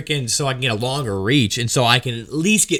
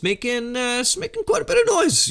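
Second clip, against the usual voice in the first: squeaky high voice; 11 semitones higher, 3 dB quieter than usual. Retold or imitated. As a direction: voice jumps way up high to mimic the noise. Imitating the scraping noise pitch.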